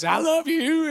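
high-pitched, doting coo; gushing grandpa. Retold or imitated. Marshmallow grandpa hugging the kids, gushing. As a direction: coo it high and sweet, imitating a softened old dad.

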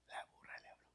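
whispered repeat; whispered emphasis. Real, not acted. Preacher to congregation, whispered dramatic repeat. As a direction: hush it for effect.

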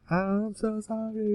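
high, slow, whimpering apology; mock crying. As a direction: imitate a sobbing plea. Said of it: "He's crying and he's like".

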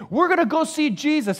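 high, loud, eager announcement; rally the reluctant kids; mock-cheerful parent; excited parent. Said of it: "the parents are going".

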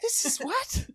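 mock disbelief. Podcast host mocks Biden's pool story with high disbelief. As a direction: pitch it way up in bewildered shock.

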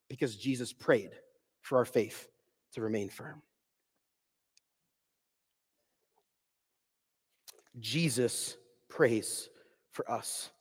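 hushed, slow closing words; soft and low. Real, not acted. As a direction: drop to a near whisper for "for us".

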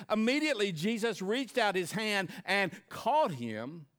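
loud, high preacher climax; preaching. Acted. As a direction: build it to a shout from the pulpit. Building sermon climax, preaching loud.

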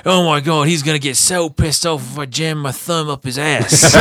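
high, loud cartoon send-up, laughing at the end; Steve Irwin parody. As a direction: play an over-excited cartoon wildlife host. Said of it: "like, South Park episodes".

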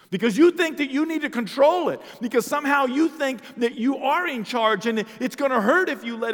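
loud, high, fervent sermon; rising revival preacher's cry; full-force preaching. Acted. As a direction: preach it out to the back row.

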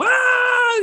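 put-on very high, long kid's shriek; imitated joyful squeal. Boy still playing in the pool, imitated joyful squeal. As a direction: let out a sustained happy squeal. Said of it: "he kept on squealing for joy".

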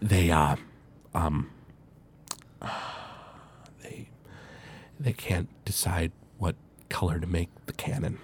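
low, quiet, halting admission; exhausted, deflated murmur; tired, fed up. Acted. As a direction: reluctantly admit it with long weary pauses. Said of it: "really tired".

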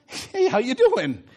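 slow, laid-back comic hello; casual greeting. Acted. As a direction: toss out an easygoing, drawn-out greeting. Jesus casually greets struggling rowers.

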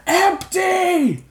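high, far-off angry crowd yell; a militiaman shouting at Alphonse. A narrator's reading. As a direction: hurl the insult from the charging mob. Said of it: "clear shouts of angry voices".

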